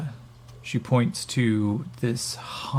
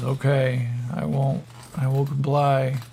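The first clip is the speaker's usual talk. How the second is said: higher-pitched dazed agreement; compliant stoned captive; 3 semitones higher than usual. Acted. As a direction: give in, voice pitched up. Stoned captive answers his captor.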